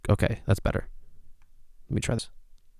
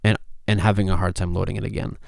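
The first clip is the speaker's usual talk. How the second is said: usual speaking voice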